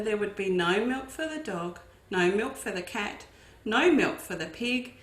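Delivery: ordinary delivery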